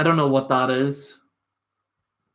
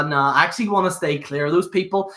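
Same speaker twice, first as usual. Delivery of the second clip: normal talking voice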